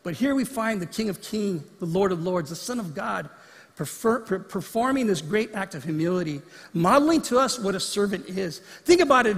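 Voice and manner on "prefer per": usual speaking voice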